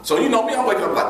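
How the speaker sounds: normal talking voice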